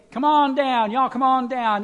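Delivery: big mock come-one-come-all call. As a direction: holler them down to the front. Acted huckster's invitation to the crowd.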